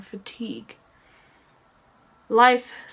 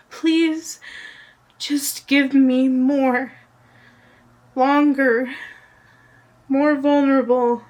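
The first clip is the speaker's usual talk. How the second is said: higher, louder plea in separated phrases; pleading prayer; 4 semitones higher, 5 dB louder than usual. Real, not acted. Poet praying to time itself for more, pleading and raised. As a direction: build to an insistent plea, pausing between requests.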